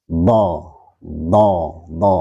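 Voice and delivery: "do" pronounced thick and weighty; heavy dhad. Shown as a demonstration. Teacher demonstrating the heavy quality to students, weighty "do". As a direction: give each "do" a heavy emphatic sound. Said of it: "itu berat".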